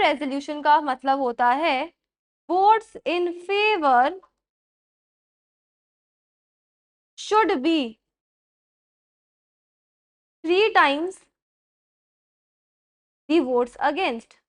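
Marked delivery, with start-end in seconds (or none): 2.5-14.3 word by word, very slow, for students to write; spaced-out dictation pace. Shown as a demonstration. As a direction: dictate each phrase slowly with pauses. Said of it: "slow dictation of the rule".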